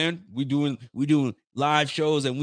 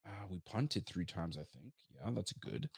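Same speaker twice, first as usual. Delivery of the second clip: much softer and lower, trailing off; deflated mumble; 4 semitones lower, 12 dB quieter, slower than usual. Retold or imitated. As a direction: mumble it like you're scraping for positives. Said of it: "I'm gonna be sitting here going".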